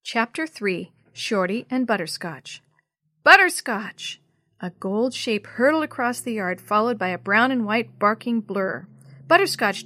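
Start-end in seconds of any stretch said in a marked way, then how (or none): 3.2-4.2 loud, higher cry after a runaway pet; calling out. A narrator's reading. Girl yelling after her runaway cat, calling out. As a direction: call the cat's name out urgently.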